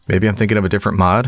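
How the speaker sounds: usual speaking voice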